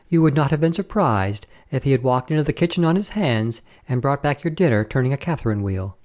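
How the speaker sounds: ordinary delivery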